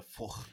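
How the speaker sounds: "fuck" with a throaty, scraped Liverpool ending; Liverpudlian pronunciation. Shown as a demonstration. Showing the disliked sound, guttural Liverpudlian "fuck". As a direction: say "fuck" as "fookh", guttural Scouse. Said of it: "that Liverpudlian accent".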